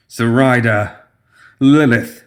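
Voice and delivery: a mild alarmed shout of names; calling out. A narrator's reading. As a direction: call them sharply in alarm.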